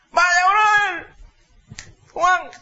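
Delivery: high, slow, drawn-out buddy greeting; exaggerated high sweet-talking pal; fawning friend. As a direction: flatter the rich kid like a hanger-on. Freeloading friends greet rich son.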